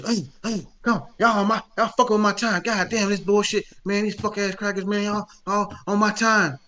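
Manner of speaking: high, loud ranting woman; playing the furious lady in line; angry customer impression. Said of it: "So she".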